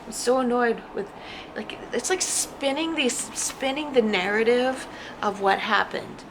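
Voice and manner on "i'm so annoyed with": lightly voiced annoyed grumble; a hint of annoyed whining; mock annoyed. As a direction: play the fed-up ex, half-hearted. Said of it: "And you're like".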